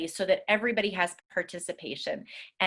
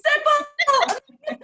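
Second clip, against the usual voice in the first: very high, loud squeal of delight; thrilled and half laughing; gleeful shriek; 7 dB louder than usual. Real, not acted. Teacher squeals praising the game.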